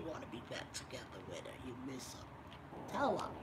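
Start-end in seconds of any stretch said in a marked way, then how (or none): none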